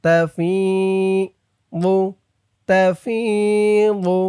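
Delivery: তাফিদু slow, the i of fi stretched one count; madd on fi. Shown as a demonstration. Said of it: "এক আলিফ টান, জেরের পরে ইয়া সাকিন". (তাফিদু ফি এক আলিফ টেনে)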